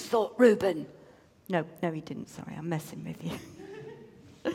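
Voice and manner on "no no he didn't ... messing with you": laughing aside. Real, not acted. Narrator's joking aside.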